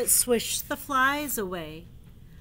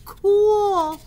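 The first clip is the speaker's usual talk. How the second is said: building elephant wail; 7 semitones higher, 11 dB louder, slower than usual. Acted. Gerald voiced in book.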